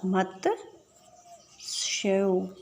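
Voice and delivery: मत्स्यौ stretched long and soft; very slow soft answer. Shown as a demonstration. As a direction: draw मत्स्यौ out for the class. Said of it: "तो क्या होगा?".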